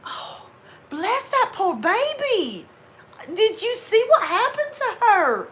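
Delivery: high, drawn-out Southern fussing; gushing Southern sympathy. Acted. Southern woman over a mistreated poor baby, gushing sympathy. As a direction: coo pityingly like a Southern woman, high and slow. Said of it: "Well, it's usually".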